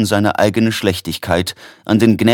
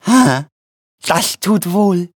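high, slow, feeble sigh of relief; faint smile in a drawn-out high voice; matt lächelnd; 10 semitones higher, slower than usual. A narrator's reading. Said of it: "sagte Quillipi matt lächelnd". (matt lächelnd, hoch und gedehnt)

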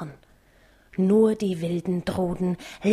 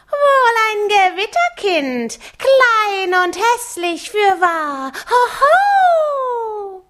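very high, slow mocking howl; howling harpies; 12 semitones higher, 5 dB louder, much slower than usual. A narrator's reading. Harpies jeer at Mattes in a very high slow howl. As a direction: shriek and jeer like wild harpies. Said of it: "heulten die Wildruden".

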